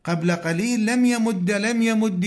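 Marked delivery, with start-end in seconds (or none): 0.9-1.5 "يمد" ending in "a", yamudda; fatha ending. Shown as a demonstration. Teacher recalling the earlier jussive forms, "يمد" with fatha. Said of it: "الفتح يجوز أخف الحركات". 1.5-2.3 "يمد" ending in "i", yamuddi; kasra ending. Shown as a demonstration. Teacher recalling the earlier jussive forms, "يمد" with kasra. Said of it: "والكسر أيضًا يجوز".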